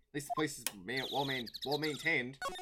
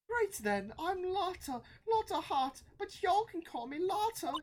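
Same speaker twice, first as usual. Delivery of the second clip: high, lilting Southern voice; friendly Southern woman; slower than usual. Acted. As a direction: introduce yourself with a high Southern twang.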